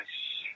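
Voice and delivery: soft, voiceless "shh"; a hushed imitation of a swishing door; imitated door shush. Drummer recalls producer walking through the doors, imitated soft shush.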